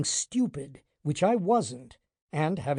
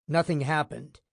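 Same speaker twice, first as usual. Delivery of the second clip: ordinary delivery, nothing marked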